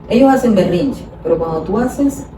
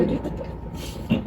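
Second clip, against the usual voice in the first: usual speaking voice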